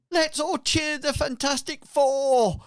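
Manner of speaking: high, loud crowd cheer; rallying shout. Acted. Villager rallying the town behind the heroes, high shout. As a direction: call out to rouse the whole crowd. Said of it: "shouts out".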